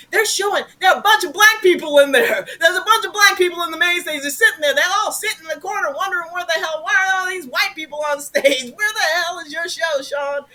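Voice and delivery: high, loud and fast angry mother; mom's angry voice. Retold or imitated. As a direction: rattle it off like a furious mom. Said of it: "my mom ... is like".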